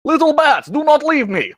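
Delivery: high, pleading character cry; Lance. Acted. Calling after the departing little bat, high pleading character voice. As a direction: call out desperately in a very high voice. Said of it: "Lance goes".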